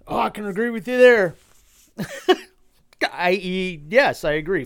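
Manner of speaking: mock the president agreeing cheerfully; mocking Biden. Vlogger mocks Biden agreeing with the heckler, hammed-up. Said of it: "he agrees with a guy that says".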